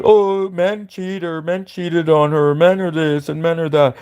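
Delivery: slow, whiny put-on sneer at a pop star. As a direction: drag it out like a mocking parody of a complaining singer. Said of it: "she's like".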